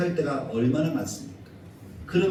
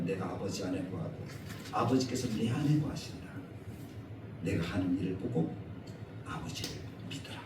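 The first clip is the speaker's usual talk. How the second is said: soft quoted Jesus; 7 semitones lower, 9 dB quieter than usual. Retold or imitated. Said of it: "예수님은 말씀하는 거예요".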